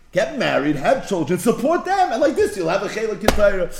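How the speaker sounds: a father pressing his son to get married, with mild insistence; a lecturing father. Retold or imitated. Father tells his son to marry, in a lightly voiced insistent lecture.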